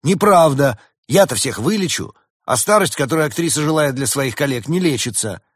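high, loud self-defence; heated protest. Acted. Doctor defends himself against the comparison, heated protest.

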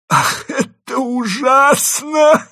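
high, slow, loud theatrical lament; fake sobbing wail. A narrator's reading. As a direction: wail with no real tears. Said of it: "бесслёзно зарыдал".

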